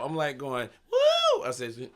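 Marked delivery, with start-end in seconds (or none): 0.9-1.4 high, loud whoop; whooping cheer. Retold or imitated. Comedian hyping up the crowd, with a loud high whoop. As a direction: let out a big high woo.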